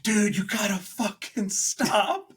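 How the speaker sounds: put-on high anguished plea to a drunk friend; desperate plea. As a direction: beg him to stop talking. Pleading with friend.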